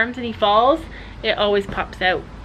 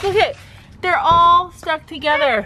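grossed-out exclaim; 6 semitones higher, 4 dB louder than usual. Real, not acted. Shocked at mating bugs.

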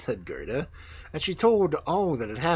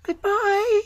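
put-on very high, loud little-girl call; high, ringing farewell; 9 dB louder than usual. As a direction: call goodbye loudly in a high child's voice. Gerda calls farewell.

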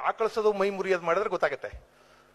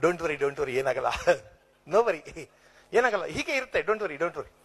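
lower, slow, empty reassurance; clumsy consoling; 5 semitones lower, slower than usual. Retold or imitated. Someone consoling a person in trouble, clumsy and lower. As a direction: offer hollow comfort, lower and slow. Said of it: "ಅವ್ರಿಗೆ ಸಮಾಧಾನ ಹೇಳಕ್ ಬರಲ್ಲ".

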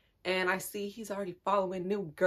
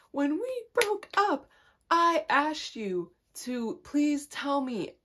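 slow, high, whiny plea; mock hurt confrontation; 8 semitones higher, 3 dB louder, slower than usual. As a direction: imitate a wounded confrontation, drawn out and high. Acts out confronting the ex.

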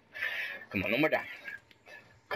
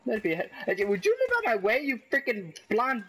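high outburst climbing to a squawk; irritated yelling; 8 semitones higher than usual. Real, not acted. Player yelling at a teammate in the way, irritated yelling. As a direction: snap at someone blocking the shot. Yelling at character blocking shot.

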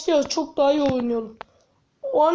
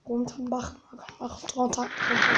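whisper; 4 semitones lower, 8 dB quieter than usual. Real, not acted. Sneaking near enemies in game.

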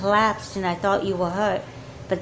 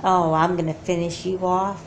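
ordinary talk, nothing marked

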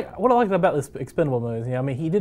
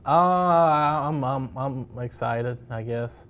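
half-hearted, hesitant excitement; unenthused; much slower than usual. Real, not acted. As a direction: say it with no conviction at all. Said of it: "You sound pumped".

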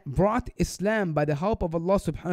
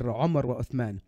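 ordinary talk, nothing marked

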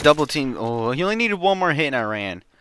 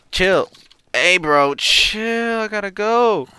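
yelling chill; 8 semitones higher, 5 dB louder, slower than usual. Real, not acted. Yelling at attacking mob.

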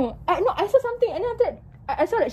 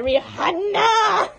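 imitating co-host; 6 semitones higher, much slower than usual. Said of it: "I'm just there like".

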